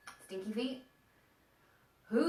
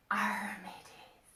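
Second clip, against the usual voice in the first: slow whispered pirate growl; hushed, drawn-out pirate arr; 4 dB quieter, slower than usual. Pretend. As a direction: rasp it out softly like a pirate. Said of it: "what do pirates say?".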